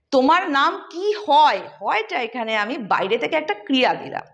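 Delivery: ordinary talk, nothing marked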